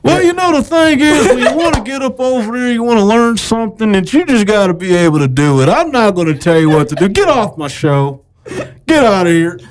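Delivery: high, preachy TV host voice; Doctor Phil. Pretend. Host to his guest, preachy TV doctor voice. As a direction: lecture like a daytime TV doctor. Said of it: "You kinda sounded like Doctor Phil when you went into that voice".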